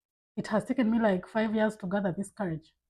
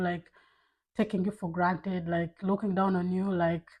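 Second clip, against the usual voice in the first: ordinary talk, nothing marked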